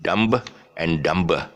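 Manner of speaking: "dumb, and dumber" as DUM-b and DUM-ber; slow "dumb, and dumber" with the B released; B sounded. Shown as a demonstration. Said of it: "a lot of people pronouncing that movie".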